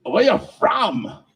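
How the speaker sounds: high, slow, drawn-out question from a curious customer; mock nosy customer. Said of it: "first thing they say is".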